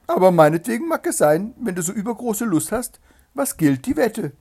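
high, loud, condescending hare; haughty hare. A narrator's reading. Hare taking up the hedgehog's bet, condescending.

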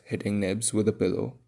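plain reading voice

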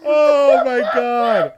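loud, high, drawn-out wail; overcome with laughter. Real, not acted. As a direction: cry it out at the end of a laughing fit. Breathless from a laughing fit.

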